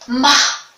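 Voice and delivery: "Mar" loud, leaning hard on the final R; stressed R demo. Shown as a demonstration. Teacher to pupils, drawing out the R in "Mar". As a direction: hold and vibrate the R at the end of "Mar". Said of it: "a gente senta aqui".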